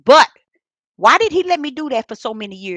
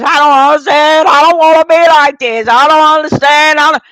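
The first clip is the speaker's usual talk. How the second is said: much louder, crying out at the altar; loud wailing; 4 semitones higher, 10 dB louder than usual. Retold or imitated. Retelling crying out to God at the altar, loud and distraught. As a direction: wail it out, beside herself. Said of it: "I was cutting up".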